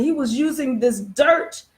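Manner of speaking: normal talking voice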